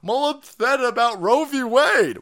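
high, loud mocking whiny voice; sneering parody of a whiny armorer. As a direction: ham up a whiny airhead wailing. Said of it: "And the armorer goes".

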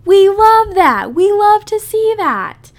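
high, loud, drawn-out cheering, slow on love; gushing hype cheer. Retold or imitated. To a friend who adores herself, gushing hype cheer. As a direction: hype her up like a proud best friend. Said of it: "and I'm like".

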